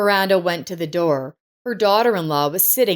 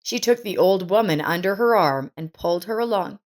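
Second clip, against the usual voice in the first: ordinary delivery, nothing marked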